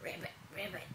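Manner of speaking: hushed frog croaks; soft ribbit. Shown as a demonstration. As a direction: croak it softly to the child. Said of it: "shows frog sound to toddler".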